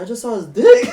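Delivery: loud, high laughing yell; shocked shout. Retold or imitated. Telling friends about the club, a loud shocked yell. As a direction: blurt it out in shocked disbelief.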